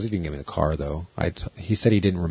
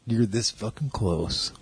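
slow, quieter tough-guy threat; menacing; 4 dB quieter, slower than usual. Retold or imitated. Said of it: "And he's like".

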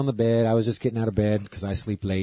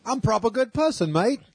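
put-on much higher jokey British accent; answering the talk of proper people in a mock British accent; 12 semitones higher than usual. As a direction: play the proper British gent.

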